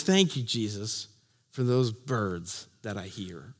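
soft, low murmured prayer; whisper-quiet gratitude; hushed thanks. Acted. Blind man thanking Jesus for birdsong, low and soft.

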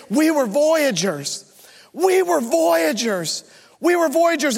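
rising to a high, slow cry of "We were voyagers"; screaming. Acted. Girl on the shore screaming her discovery, mock yell. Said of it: "she starts screaming it".

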